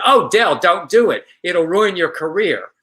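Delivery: a friend begging him not to do it, alarmed. Retold or imitated. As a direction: plead with him to stop, shocked. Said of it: "she said".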